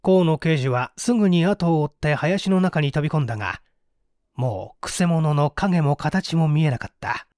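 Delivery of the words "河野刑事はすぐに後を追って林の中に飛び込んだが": ordinary delivery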